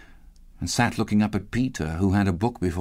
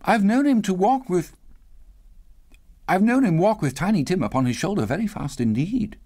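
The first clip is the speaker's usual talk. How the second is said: high, steady brave cheer; mother's brave high-pitched cheerfulness; cheerful, faltering once; 10 semitones higher than usual. A narrator's reading. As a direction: keep it bright, break off once, resume. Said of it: "in a steady, cheerful voice that only faltered once".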